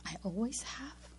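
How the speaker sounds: soft whispered question, rising at the end; quietly questioning your own claim; doubtful whisper. Retold or imitated. Doubting own answer back in chair.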